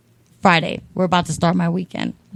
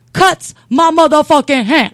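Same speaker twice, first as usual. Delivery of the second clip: higher, louder outrage; outraged; 8 semitones higher, 6 dB louder than usual. Retold or imitated. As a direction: burst out indignant. Outraged recount.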